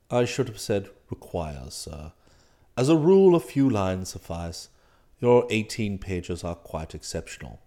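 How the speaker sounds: unruffled valet. Acted. Valet explains club rules to master, unruffled. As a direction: explain deferentially, deep and composed.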